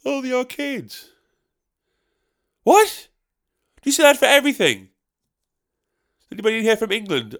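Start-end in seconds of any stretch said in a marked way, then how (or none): 0.1-1.1 mock heckler. 2.6-4.9 high, loud incredulous What; baffled outburst. Retold or imitated. Baffled reaction to heckler.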